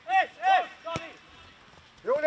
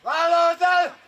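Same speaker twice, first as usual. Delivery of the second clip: shouted coaching; 3 dB louder, slower than usual. Real, not acted. Coach urges player to take it on, shouting. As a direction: holler it to a distant player.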